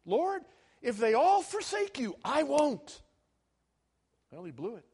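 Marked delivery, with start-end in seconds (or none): 0.1-3.0 loud, high-pitched vow of loyalty; Peter's prideful boast. Acted. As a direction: declare it proudly, raised and forceful. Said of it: "it was, it was pride".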